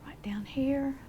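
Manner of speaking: whisper. Real, not acted. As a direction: whisper it as you place the stroke. Painter murmurs while working.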